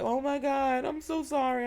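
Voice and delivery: high, softened mock-tearful voice; nearly crying apology. Friend apologizing for leaving her in the water, imitated near-tears. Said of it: "My friend was about to cry".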